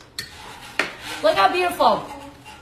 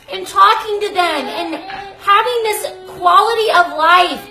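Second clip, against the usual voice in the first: usual speaking voice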